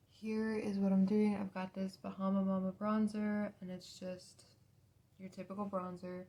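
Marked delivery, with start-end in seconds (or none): none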